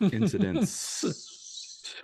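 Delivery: teasing drawn-out repeat. Acted.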